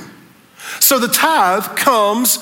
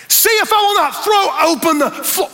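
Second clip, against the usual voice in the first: rising higher through the verse; preaching build; 9 semitones higher than usual. Acted. Pastor building to the word floodgates for the church. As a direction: climb toward the key word.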